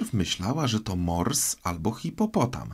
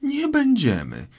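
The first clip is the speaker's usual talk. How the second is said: very high, outraged squeal; shrill, affronted mousy shout; indignant cry; 4 dB louder than usual. A narrator's reading. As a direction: throw the words back as a furious mouse. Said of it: "krzyknęła mysz, trzęsąc się".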